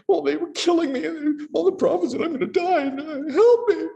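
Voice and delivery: high, frantic, pleading voice; panicked whining plea. Retold or imitated. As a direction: play a scared prophet begging for help. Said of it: "Elijah takes it that way and he says".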